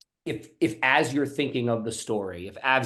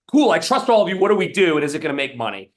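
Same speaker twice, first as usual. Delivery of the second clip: a high, loud, blunt boss demanding the bottom line; blunt CFO; 5 semitones higher, 6 dB louder, faster than usual. Retold or imitated. CFO demanding answers from her team, high and loud and blunt. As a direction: play the impatient finance chief who wants it short. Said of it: "she doesn't wanna wade through".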